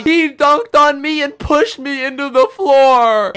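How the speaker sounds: a mock sob-story wail in a kid's voice; imitating bawling kid. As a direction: play the crying tattletale. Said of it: "starts bawling his eyes out".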